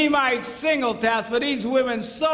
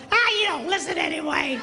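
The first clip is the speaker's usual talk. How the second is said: higher, dismissive mock holler; yelling back; 5 semitones higher than usual. Wife yelling back that husband never listens, dismissive holler. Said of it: "She yells back".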